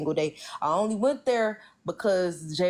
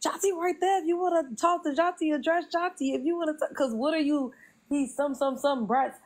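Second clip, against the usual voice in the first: high-pitched retold telling-off; 10 semitones higher than usual. Woman retells redirecting him to Jonty, high exasperated telling-off. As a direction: re-enact the challenge in a higher voice.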